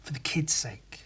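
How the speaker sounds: soft unvoiced whisper. Real, not acted. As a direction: breathe it out as a hushed aside. Whispered close of the story.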